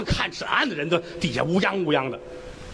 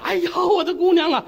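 higher, louder acted wail over her daughter; mock lament of a mother for her dead girl; 10 semitones higher, 3 dB louder than usual. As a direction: cry out as if grief-struck. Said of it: "这姑娘他妈哭坏了".

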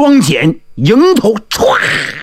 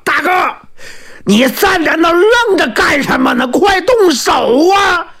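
loud shout; 9 semitones higher than usual. Acted. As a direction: shout at him to attack now. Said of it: "张信大叫".

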